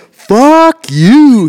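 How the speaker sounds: high, loud mock fury; drawn-out retold angry yell; ranting husband. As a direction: blow up, imitating a man gone bananas. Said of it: "Goes bananas".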